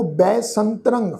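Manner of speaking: ਬੈਸੰਤਰੰ said slowly with ਬੈ and a nasal ਸੰ; careful pronunciation. Shown as a demonstration. Teacher warning the sangat, slow careful pronunciation. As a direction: spell out ਬੈਸੰਤਰੰ syllable by syllable, slowly. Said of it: "ਸਾਧ ਸੰਗਤ ਧਿਆਨ ਰੱਖੋ".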